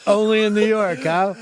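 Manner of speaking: mock yell. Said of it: "I'll yell".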